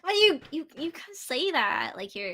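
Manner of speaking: drawn-out mock American; American accent. Shown as a demonstration. Brit teasing a friend in swapped accents, mock American accent. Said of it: "I'll become American".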